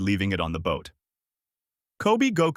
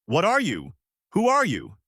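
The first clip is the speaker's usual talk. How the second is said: bewildered yell; 8 semitones higher than usual. A narrator's reading. Alvida to the boys who beat her, bewildered high yell. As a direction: yell both questions, the second higher. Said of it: "before yelling out".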